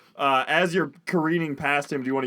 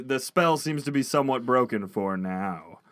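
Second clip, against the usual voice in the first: ordinary talk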